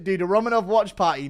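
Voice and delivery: loud, high burst of delight; excited outburst. Real, not acted. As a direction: blurt it out, cracking up. Laughing burst about the stream.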